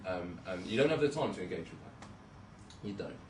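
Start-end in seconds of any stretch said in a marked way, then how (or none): none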